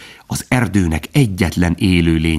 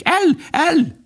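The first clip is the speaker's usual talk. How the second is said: very high, piping tit call; chirping bird cry. A narrator's reading. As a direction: chirp it like tiny alarmed birds. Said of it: "és egyre csiripelték". (magas, csiripelő madárhang)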